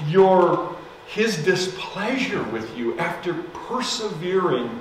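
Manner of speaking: high, urgent pleading. Retold or imitated. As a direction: beg her to turn back, voice rising. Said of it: "I pleaded with her".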